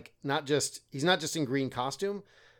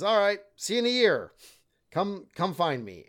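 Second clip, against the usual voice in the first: higher, a touch louder parting challenge; lightly voiced Green Knight; 7 semitones higher, 4 dB louder than usual. Beheaded Green Knight to Gawain, lightly voiced casual challenge. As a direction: lightly play a knight issuing a casual send-off. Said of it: "the Green Knight picks up his head".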